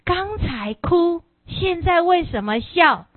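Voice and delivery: slow, high baffled question, mock bewildered; puzzled bystander. Confused onlooker asking the monk why he laughs now, slow high bafflement. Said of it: "他说".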